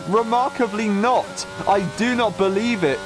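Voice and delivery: astonished. Real, not acted. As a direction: disbelief as the drama unfolds. Incident on track, commentator astonished.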